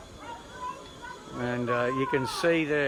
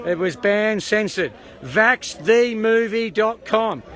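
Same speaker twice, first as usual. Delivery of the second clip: crowd shout; 7 semitones higher, 7 dB louder, slower than usual. Real, not acted.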